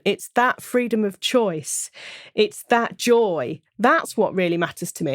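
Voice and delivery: ordinary talk, nothing marked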